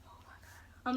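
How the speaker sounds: whispered, anxious; nervous whisper. Retold or imitated. Girl dreading the pregnancy test, whispered. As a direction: breathe it out in a whisper.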